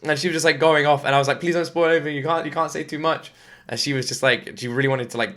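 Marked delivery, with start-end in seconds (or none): 1.4-3.3 pleading. Retold or imitated. Begging wife not to spoil.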